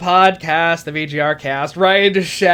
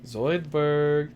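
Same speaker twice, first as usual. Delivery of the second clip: Zoidberg; 5 semitones lower, 9 dB quieter than usual. Acted. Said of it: "He says Zoidberg".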